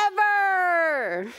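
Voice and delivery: high, loud, drawn-out cheer; thrilled, high-pitched exclamation; excited storybook voice. Acted. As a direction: stretch it out with big excitement. Ends an excited storybook line.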